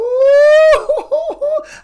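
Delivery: high, loud whooping laugh; excited laugh. Real, not acted. Host excited to quiz viewers, loud whooping laugh. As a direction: burst out laughing with glee. Excited outburst after a question.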